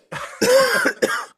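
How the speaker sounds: hard choking cough; coughing fit. Real, not acted. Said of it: "it got me crying".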